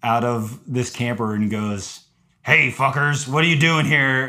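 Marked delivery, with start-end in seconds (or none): 2.4-4.2 mock-gruff old man challenge; lightly acted, irate landowner; retold angry challenge. Old dude in a camper challenging hikers, mildly irate.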